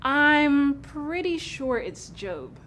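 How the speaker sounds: "Job" with a long o, like "robe"; insistent correction. Acted. Corrects the name back, slowly.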